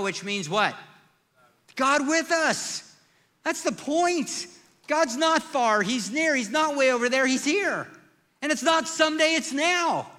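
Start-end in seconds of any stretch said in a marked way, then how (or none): 1.8-10.1 louder, higher preaching climax; rising sermon peak; preaching at full force. Acted. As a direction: drive each short claim home to the congregation. Preaching climax on God being near.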